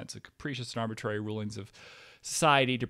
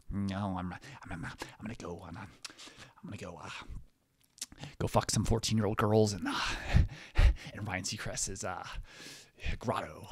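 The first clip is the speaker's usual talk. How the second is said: lightly imitated, halting mumble; Leonardo DiCaprio; 2 semitones lower than usual. Imitating an actor musing on his plans, halting mock voice. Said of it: "he's just like".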